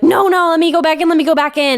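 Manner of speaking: Owen pleading. Retold or imitated. Son begging his dad to let him back in, pleading. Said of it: "he's like".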